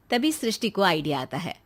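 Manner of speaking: ordinary talk, nothing marked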